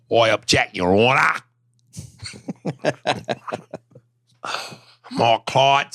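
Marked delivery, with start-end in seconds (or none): 2.2-3.8 soft laugh. Real, not acted. Laughs after own joke.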